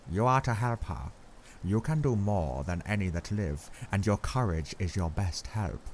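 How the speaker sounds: put-on grave old Dutch professor, accented, measured; Van Helsing's accent. A narrator's reading. Professor gravely telling Arthur Lucy is very bad, accented and grave.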